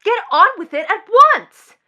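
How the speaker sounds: loud, high demand; impatient outburst. Acted. Daughter to director who delays the scene, impatient outburst. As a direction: explode with impatience.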